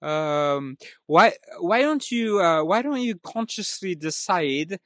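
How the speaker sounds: loud, high, hammed-up French accent. Dad asking his wife to be on time, loud French accent. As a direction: call out as a halting Frenchman, French accent laid on thick. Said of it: "I can only do the, uh, French".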